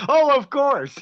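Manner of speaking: high, loud mocking echo; mock agreement. Streamer mimics officer's answer from the clip, loud mock agreement. Echoing the officer's reply while laughing.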